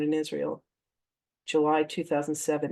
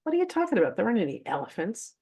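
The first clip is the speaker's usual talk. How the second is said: imitating a baffled friend, starting much higher; incredulous retort; 4 semitones higher, faster than usual. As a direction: act out her disbelief, pitch leaping up. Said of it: "And Jill goes".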